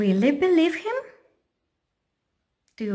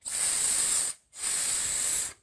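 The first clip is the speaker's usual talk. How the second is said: soft unvoiced hiss; sneaky snake hiss; 12 dB quieter than usual. Triangle pretends to be snake.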